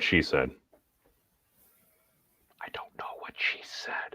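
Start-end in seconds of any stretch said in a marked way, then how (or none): 2.6-4.2 breathy whispered aside; whispering. Real, not acted. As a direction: whisper it under your breath. Aside about a foreign phrase.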